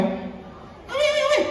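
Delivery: very high, slow put-on voice; squeaky mock listener. Comedian voices a heckler asking, high and slow. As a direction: pipe it up slow like a cartoon kid.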